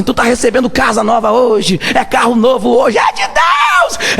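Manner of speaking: high, stretched cry on de Deus; mock prosperity preacher. Mocking a prosperity preacher shouting to the crowd, stretched high. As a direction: ham up a hype preacher shouting blessings. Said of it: "pregador falava assim".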